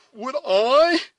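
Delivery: high, loud, outraged echo; indignant, astonished. A narrator's reading. Duncan answering Cora's question, indignant astonishment. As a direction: throw the question back in shock. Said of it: "echoed the indignant and astonished youth".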